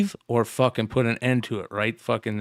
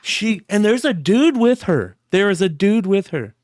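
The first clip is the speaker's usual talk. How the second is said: excited; 8 semitones higher, 4 dB louder than usual. Real, not acted. Excited retelling of the clip.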